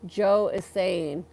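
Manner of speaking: ordinary delivery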